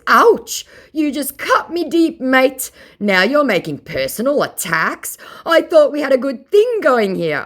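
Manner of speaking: mock wounded scammer. Mocking the scammer pretending to be hurt, high hammed-up whine. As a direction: overplay the wounded feelings.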